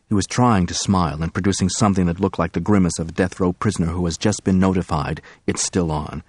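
plain reading voice

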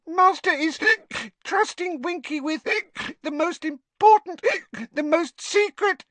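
high elf voice, halting and proud; a tipsy elf boasting of secrets; drunk proud elf. A narrator's reading.

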